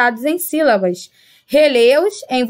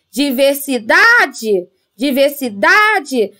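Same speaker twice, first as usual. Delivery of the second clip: diversidade drawn out slowly, leaning hard on DA; stress on DA; slower than usual. Shown as a demonstration. Teacher showing pupils the strong syllable, diversidade stretched on DA. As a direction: call out diversidade with a long, strong DA. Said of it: "podemos ouvir aqui a letra dá com mais força".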